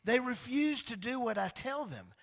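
hammed-up angry God voice. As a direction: let God's anger rise up. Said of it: "I was so angry with them".

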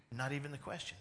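usual speaking voice

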